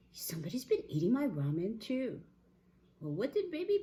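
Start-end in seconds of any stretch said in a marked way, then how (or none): 0.1-2.2 higher, milder bear voice; a touch of higher-voiced dismay; Mama Bear. Mama Bear at her ramen, mildly dismayed.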